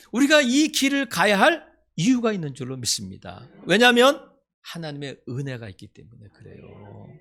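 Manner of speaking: impassioned pulpit crescendo; full-force preaching. Acted. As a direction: build the congregation up with fire. Preaching climax.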